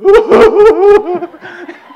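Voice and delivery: long, high, loud mock wail; imitated wailing sob. Newcomer running for the door, imitated wailing. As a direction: howl in hammed-up sobs. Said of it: "and I'm sobbing".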